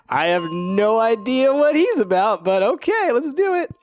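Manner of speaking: high, loud, incredulous amusement; amused bafflement. Real, not acted. Player baffled by the dwarf guy, amused. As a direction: pitch it up high, giddy with confusion.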